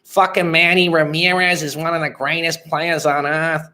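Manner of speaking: high, hammed-up Boston accent. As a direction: imitate a die-hard Boston fan, accent thick. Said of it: "That's the accent I'm thinking of".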